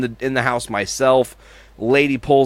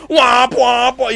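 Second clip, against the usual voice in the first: high honking womps; imitated car horn; 10 semitones higher, 4 dB louder, slower than usual. Storyteller mimicking horn held to phone, imitated honking. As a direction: blare it like a horn down the line.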